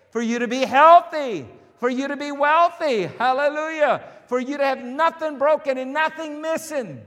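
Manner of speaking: high, loud, jubilant preacher; full-force preaching. Real, not acted. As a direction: build up the praise and proclaim it to the congregation.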